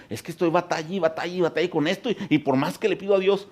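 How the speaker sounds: high, fast complaining voice; mock complaint of a struggling believer; imitating a weary believer. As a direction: whine as a tired believer. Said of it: "O quizá tú dices".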